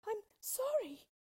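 put-on high, loud frightened girl; frightened high-pitched outburst; stammered apology. A narrator's reading. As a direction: apologise in a high, alarmed girl's voice. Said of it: "stammered Dorothy".

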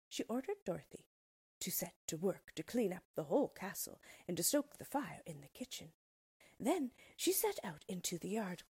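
plain reading voice

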